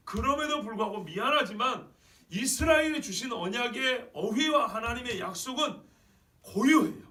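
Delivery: loud, high, emphatic sermon voice; full-force preaching. Real, not acted. Preacher declaring Israel's covenant to the congregation, full-force preaching.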